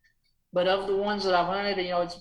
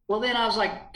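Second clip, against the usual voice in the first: usual speaking voice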